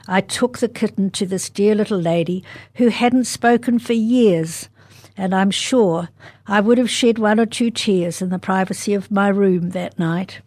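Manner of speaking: ordinary delivery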